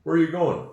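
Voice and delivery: "going" said as goin', G dropped, run together; relaxed goin' with no emphasis; lazy, sloppy. Shown as a demonstration. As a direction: toss it off casually, no G.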